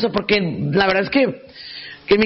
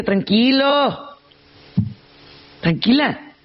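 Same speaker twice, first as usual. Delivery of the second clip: drawn-out calming; 3 semitones higher, much slower than usual. Acted. Psychologist telling an imagined frantic person to relax, drawn-out calming. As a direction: draw it right out to slow someone down.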